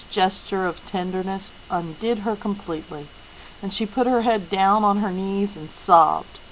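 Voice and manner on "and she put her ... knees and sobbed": ordinary delivery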